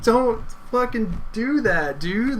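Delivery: high-pitched, drawn-out protest; exasperated outburst. Retold or imitated. Scolding the cop for the prank, high-pitched exasperation. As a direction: protest slowly in high-pitched relief and outrage.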